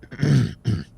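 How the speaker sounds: loud throat clearing. A narrator's reading. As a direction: clear throat before speaking. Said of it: "Paul cleared his throat".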